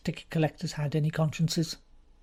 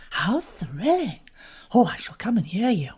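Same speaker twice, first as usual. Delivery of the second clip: Frankie, thrilled; 4 semitones higher, 3 dB louder than usual. Acted. To Bobby, a young woman eager to hear him give evidence. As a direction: gush with delight over the inquest.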